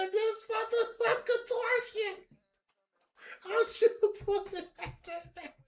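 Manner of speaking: excited. Real, not acted. Card animation excites him.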